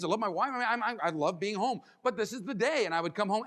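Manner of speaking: ordinary talk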